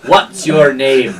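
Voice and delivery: emphatic, slowing on the question; accusing gatekeeper. Acted. Gatekeeper demands the real name, accusing. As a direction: call out the lie, then draw out the demand. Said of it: "and he goes".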